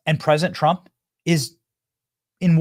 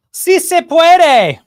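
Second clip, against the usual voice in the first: mock rally chant, much higher, much louder and very slow; imitating Jill Biden; 13 semitones higher, 10 dB louder, much slower than usual. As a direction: chant it drawn out like a cheering crowd. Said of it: "To quote Jill Biden".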